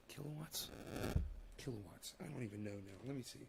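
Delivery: quiet confused mumble; stoned mumble. Real, not acted. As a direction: mutter to yourself, lost. High, muttering to himself.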